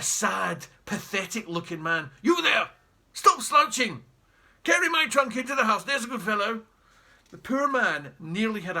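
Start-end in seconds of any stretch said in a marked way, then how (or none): 2.2-6.6 loud, barking orders to a servant; bossy command. Acted. Jeremy ordering the slouching taxi driver about, loud and bossy.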